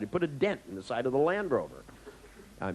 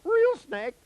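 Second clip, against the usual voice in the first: loud, very high yelp; comic high-pitched panic; mock panicked cry; 6 dB louder than usual. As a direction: imitate a terrified patient calling it out. Retold panicked patient identifying snakes.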